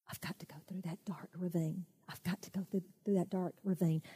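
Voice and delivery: hushed, dread-filled whisper; fearful whisper. Acted. Boy dreading the dark ravine, whispering. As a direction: breathe it out in fear. Boy's fearful inner thought.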